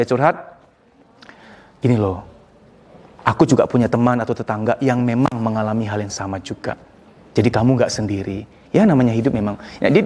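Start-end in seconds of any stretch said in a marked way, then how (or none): 1.8-8.4 low, level reassurance; gentle comfort. Shown as a demonstration. Friend consoling someone who has just vented, gently reassuring. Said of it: "Dengerin, biar dia katarsis".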